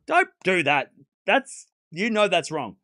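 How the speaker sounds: stern, slow and loud scolding. Real, not acted. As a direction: tell them off firmly. Scolding the chat.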